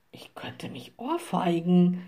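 whispered self-reproach; inner thought. A narrator's reading. Forester curses himself in thought, whispering. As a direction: mutter it under the breath, whispering. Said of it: "dachte der neue Revierförster".

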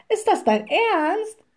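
high, astonished exclamation; called out. A narrator's reading. Witch reacts to forester's offer, calling out. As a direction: exclaim with pitch leaping up. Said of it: "rief die kleine Hexe".

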